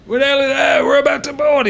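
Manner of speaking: shouted high and loud; distant shouts. Acted. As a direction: call it out to the crew across the deck. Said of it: "you hear kind of shouts from behind you".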